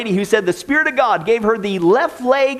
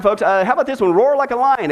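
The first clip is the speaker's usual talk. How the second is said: usual speaking voice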